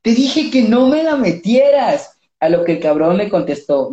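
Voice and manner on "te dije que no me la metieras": mock protesting shout; retold shout. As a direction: cry out the refusal in mock desperation. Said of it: "le grité".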